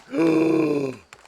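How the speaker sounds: a high, sharp gasp; delighted gasp. Real, not acted. Hearing the hamster fission idea, a delighted gasp.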